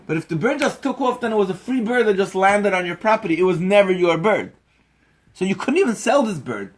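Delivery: forceful preaching. Acted. Teacher lecturing the bird seller, forceful preaching. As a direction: preach it, pressing each line harder.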